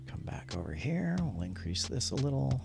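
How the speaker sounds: usual speaking voice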